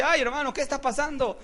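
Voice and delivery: high-pitched, wavering-in-faith imitation; lightly hammed-up alarm and retreat; mock intimidated believer. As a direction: act out someone backing away scared. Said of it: "No el que se amedrenta".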